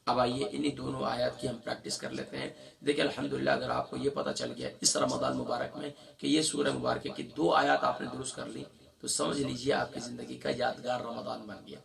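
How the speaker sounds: normal talking voice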